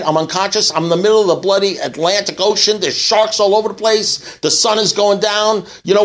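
high, frantic complaining castaway; panicked rant. Retold or imitated. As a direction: pour it out in rising alarm. Stranded man rants in panic.

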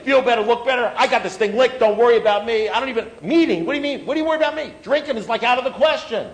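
high, loud imitation of cocksure guys; mock defiant denial. Overconfident guy waving off his sponsor's worry, mock defiant. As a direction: imitate drinkers loudly brushing off all concern.

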